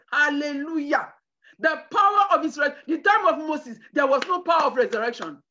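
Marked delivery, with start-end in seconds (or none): none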